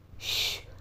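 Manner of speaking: breathy unvoiced shush; a quick secret shh to quiet a child; whispered hush. A narrator's reading. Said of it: "తల్లి రహస్యంగా చెప్పింది".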